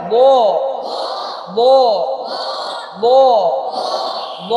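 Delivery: ব said as a thick, rounded "bo" with a held vowel; heavy, thick letter. Shown as a demonstration. Teacher drilling pupils on the thick letter, heavy held ব. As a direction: sound out ব full and heavy, one at a time. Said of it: "মোটা, মোটা হবে".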